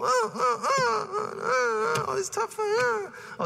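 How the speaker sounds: high, whiny fake crying; hammed-up blubbering wife; mock wailing. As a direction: ham up a sobbing wife. Priest mimics wife wailing over her day.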